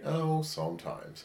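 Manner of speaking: high, soft, drawn-out imitation; mock-coy hedging; coy evasive answer. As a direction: draw it out small and high, dodging the question. Imitating partner's evasive answer on reciprocating.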